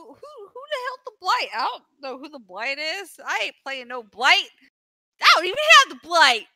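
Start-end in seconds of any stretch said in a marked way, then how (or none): 5.2-6.5 exasperated shout. Real, not acted. Flustered denial, louder.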